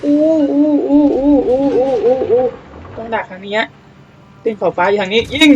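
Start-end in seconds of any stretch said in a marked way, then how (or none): 0.0-2.4 high repeated hoots; excited hooting. Real, not acted. Gamer hooting at the action on screen, excited high hoots. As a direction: hoot over and over in glee.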